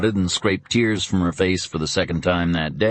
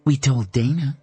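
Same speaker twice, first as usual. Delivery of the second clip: ordinary delivery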